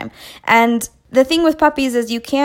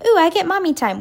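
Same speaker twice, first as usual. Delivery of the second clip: higher, eager little pet voice; perky, raised-pitch imitation of delight; delighted puppy thought; 7 semitones higher than usual. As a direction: voice an excited puppy looking forward to a treat.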